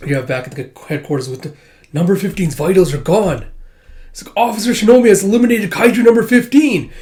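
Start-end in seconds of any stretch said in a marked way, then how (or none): 1.9-3.5 raised, higher-pitched announcement; urgent operator's report. Acted. Headquarters operator announces the kill. 4.4-6.9 high, loud victory call; triumphant announcement. Acted. Headquarters announces victory to cheers.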